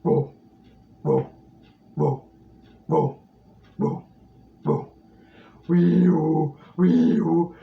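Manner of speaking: hollow, dopey starfish voice; Patrick voice. Shown as a demonstration. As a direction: drop the larynx, hollow and dopey. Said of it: "So you drop in your larynx now".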